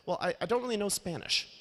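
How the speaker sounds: giddy high friend shrugging off the risks; carefree friend voiced. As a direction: toss it off like nothing could go wrong. Ryan quoted on bad news.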